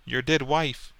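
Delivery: lightly voiced spiteful woman; catty woman. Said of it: "and she said".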